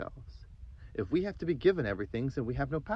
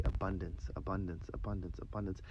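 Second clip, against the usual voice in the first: abundance repeated, fading softer each time; repeating abundance softly; 3 semitones lower, 8 dB quieter than usual. Shown as a demonstration. Host models the breathing mantra for listeners, softly fading. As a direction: murmur the word like a mantra, trailing away. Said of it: "say that word over and over".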